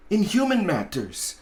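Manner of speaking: ordinary delivery, nothing marked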